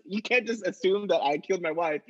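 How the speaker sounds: normal talking voice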